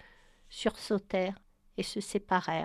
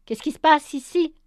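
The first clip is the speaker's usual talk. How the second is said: a higher, much louder cutting demand; sharp tone; 6 semitones higher, 9 dB louder than usual. Acted. Brian bursting into the room, sharp angry demand. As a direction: demand answers, sharp as a blade. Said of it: "d'un ton acéré".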